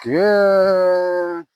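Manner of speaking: drawn-out soothing good; duration marker. Real, not acted. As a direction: hold the word long and even. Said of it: "I used my duration marker".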